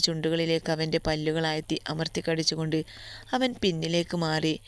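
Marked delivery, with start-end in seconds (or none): none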